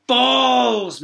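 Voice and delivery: loud, high outraged shout. Acted. Teacher spots a ball, loud outraged shout. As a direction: bellow it in disgust. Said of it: "Mister Pent would shout".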